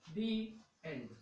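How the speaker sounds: "दी एंड" said slowly, each word apart; slow demo of the end. Shown as a demonstration. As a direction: model "दी एंड" slowly for the class. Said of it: "तो ये क्या पढ़ा जाएगा भाई". ("दी एंड" धीरे-धीरे साफ बोलें)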